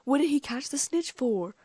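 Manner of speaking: high, loud, incredulous question; bellowed protest. A narrator's reading. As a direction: bellow it in exasperation. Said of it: "Ron bellowed".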